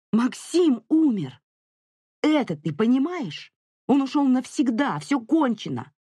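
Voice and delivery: high-pitched furious tirade; enraged mother, climbing toward a shriek; angry shouting. A narrator's reading. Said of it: "крикнула Летиция".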